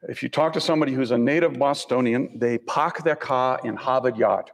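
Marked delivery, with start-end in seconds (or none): 2.6-4.5 put-on slowed Boston accent with dropped R's; mimicked Boston "pahk their cah". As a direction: drop the R's like a native Bostonian. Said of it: "They don't pronounce the R's".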